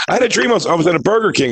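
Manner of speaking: high, overhyped setup line; hammy mock stage patter; campy delivery. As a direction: sell the dream like a hack impressionist. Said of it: "such a campy delivery".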